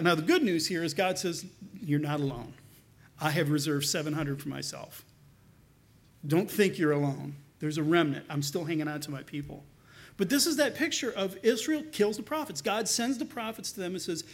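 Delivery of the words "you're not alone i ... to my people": reassuring God. Acted.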